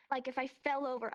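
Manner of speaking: ordinary talk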